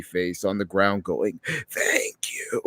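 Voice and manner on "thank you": soft, whispered character voice; hushed, unvoiced mock gratitude; creepy creature's thanks. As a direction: whisper it as a mangled little creature. Quotes fleshed-out smiley face.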